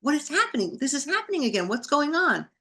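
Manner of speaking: high, bewildered retold questions; alarmed inner thought. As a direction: think it in rising alarm. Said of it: "I'm thinking in my brain".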